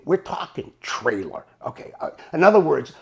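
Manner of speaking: normal talking voice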